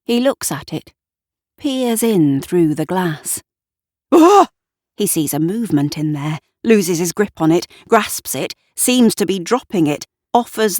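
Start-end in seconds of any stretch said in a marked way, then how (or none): 4.1-4.5 sudden high loud yelp; high yelp of fright; startled cry. A narrator's reading. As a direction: jump at the shock. Gavin startled by movement.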